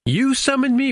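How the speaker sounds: high-pitched mock disbelief. Q looking at Guinan who summoned him, high incredulous imitation. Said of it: "looks at her and goes".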